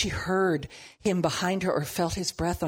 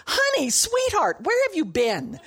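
mock sweetheart; 10 semitones higher, 4 dB louder than usual. Imagined lover greeting Jesus.

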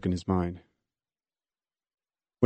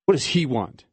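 mild anger; 4 semitones higher than usual. Acted. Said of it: "face flushed with sudden anger".

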